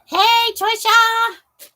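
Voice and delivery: loud slow sing-song greeting; calling out. Real, not acted. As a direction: call out her name brightly.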